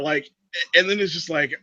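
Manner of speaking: usual speaking voice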